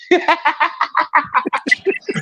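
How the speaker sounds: high, helpless real laugh; laughing at own joke. Real, not acted. Roaster after mimicking the man waiting, high laughter. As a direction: crack up at your own bit.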